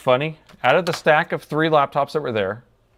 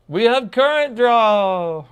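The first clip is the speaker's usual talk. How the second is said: high, loud, drawn-out cry of joy; excited shout; 12 semitones higher, 8 dB louder, much slower than usual. Real, not acted. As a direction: shout the good news slowly. Test device finally draws current.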